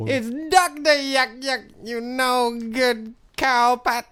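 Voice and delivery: high, drawn-out Southern farmer cry. Acted. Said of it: "the Southern Farmer guy".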